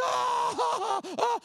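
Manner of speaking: loud, high wailing in bursts; imitated screaming cry in pulses; baby's wail. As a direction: bawl like a hurt infant. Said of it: "looked like he had two mouths".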